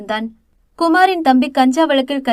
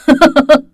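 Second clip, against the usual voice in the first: loud, menacing villain laugh; aggressive laugh; 2 semitones higher, 8 dB louder than usual. A narrator's reading. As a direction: laugh at her like a threat. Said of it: "ஆக்ரோஷமாக சிரித்தவன்".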